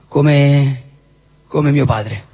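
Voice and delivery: ordinary talk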